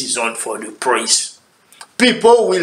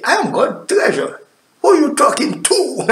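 proud declaration; 11 semitones higher, faster than usual. Pretend.

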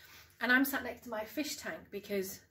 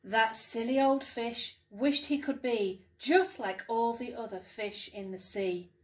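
lively storytime voice with big rises on the wishes; bouncy picture-book narration, hushed at the end; reading to children; 5 dB louder than usual. Acted. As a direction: read it like bedtime storytime for young kids. Mum reads picture book aloud.

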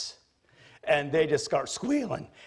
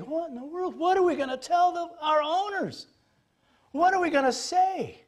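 much higher panicky herdsman voice; worried herdsmen. Pretend. Herdsmen fearing their owners' reaction, high and panicky. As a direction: fret about telling the owners. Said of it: "The herdsmen are thinking".

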